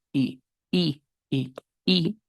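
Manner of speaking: short vowel i repeated quietly; English i drill. Shown as a demonstration. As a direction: practice i, i, like a mouth exercise. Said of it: "hagan i". (repetir i, i, como ejercicio)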